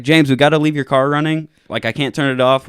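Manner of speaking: retold urgent appeal; urgent explaining. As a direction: get through to a drunk friend. Urging drunk friend to leave.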